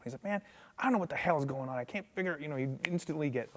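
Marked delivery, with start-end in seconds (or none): 0.2-2.4 higher-pitched retold frustration; mock super mad. Drummer stuck on the oud pieces, mildly mock-exasperated. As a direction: imitate a sudden, mild flare-up. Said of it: "he'd get super mad".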